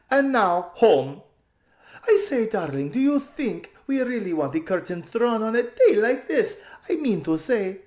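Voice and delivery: high, loud, slowly drawn out with a laugh; loud gushing drawl. A narrator's reading. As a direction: gush like a spoiled society lady. Lasaraline voiced gushing.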